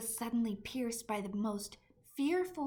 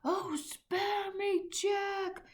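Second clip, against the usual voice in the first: high, slow, loud begging cry; yelled plea; 11 semitones higher, 5 dB louder, much slower than usual. A narrator's reading. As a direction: beg for mercy in terror. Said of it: "he yelled".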